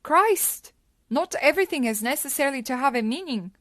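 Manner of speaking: high, loud exasperated outburst; fed up with the question. Acted. Snaps at own repeated question.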